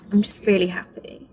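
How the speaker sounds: normal talking voice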